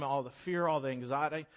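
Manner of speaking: normal talking voice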